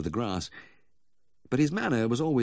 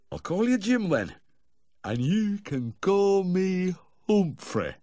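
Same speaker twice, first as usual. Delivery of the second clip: put-on high, slow drunken drawl; effusive tipsy slur, pitched high; slurred drunk; 12 semitones higher, 4 dB louder, slower than usual. A narrator's reading. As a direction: stretch the words out tipsily. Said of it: "he slurred".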